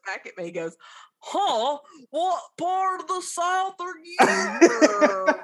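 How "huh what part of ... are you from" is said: put-on a half-done British accent, pitched higher and louder; mock British accent. A British man in the gift shop asks where she's from, in a loose mock British accent. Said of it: "That's not doing it justice".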